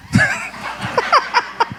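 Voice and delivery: breathless, silent high-pitched laugh at his own joke; real wheezing giggle. Real, not acted.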